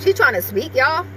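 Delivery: ordinary delivery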